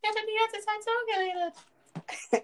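very high strained put-on voice, slow; imitating a weird disguised voice. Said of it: "der seine Stimme verstellt hat".

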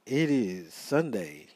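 ordinary talk, nothing marked

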